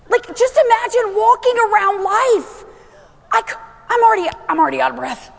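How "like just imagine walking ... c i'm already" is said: high, raised preaching voice; urgent, high-pitched sermon delivery; full-force preaching. Acted. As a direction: drive each phrase home to the congregation.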